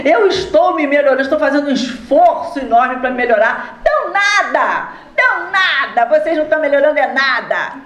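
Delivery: high, laughing mockery of the spirit; mocking spirit. Spirit at the séance mocking spiritists, high and laughing. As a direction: scoff at the spiritists through a laugh. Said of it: "Ele disse".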